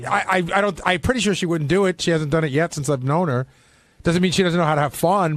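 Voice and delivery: ordinary talk, nothing marked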